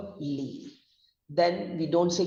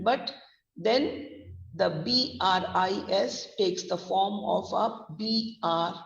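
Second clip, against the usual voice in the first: ordinary talk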